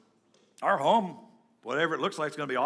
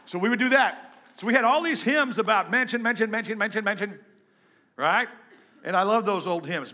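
normal talking voice

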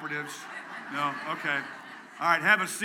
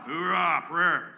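loud, higher hoorah cheer; yell; 4 semitones higher, 8 dB louder, slower than usual. Real, not acted. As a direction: cheer it like the troops. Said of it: "somebody yell".

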